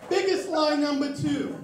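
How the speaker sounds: normal talking voice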